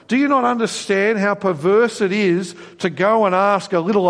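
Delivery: high, mocking, indignant voice of God; God scoffing at a king who asks idols; sarcastic God. Preacher voicing God mocking Ahaziah, high and sarcastic. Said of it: "You can actually hear the sarcasm in God's voice".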